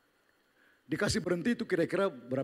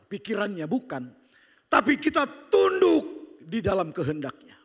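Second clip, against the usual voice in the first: preaching at full force; 11 semitones higher, 9 dB louder than usual. Acted. Loud preaching section.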